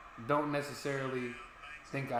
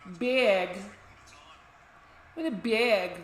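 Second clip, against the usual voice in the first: mock Wisconsin "Bag", drawn out and higher; playful Wisconsin accent impression; 6 semitones higher, 5 dB louder, much slower than usual. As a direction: imitate a Wisconsin local saying "Bag. What a bag.". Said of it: "they'll say bag".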